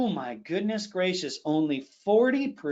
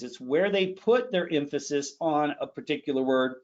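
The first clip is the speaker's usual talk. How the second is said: ordinary talk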